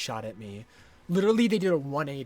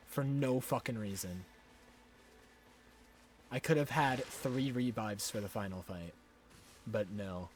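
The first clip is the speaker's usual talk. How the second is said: defeated grumble; 8 semitones lower, 9 dB quieter than usual. Real, not acted. Defeated after losing revive.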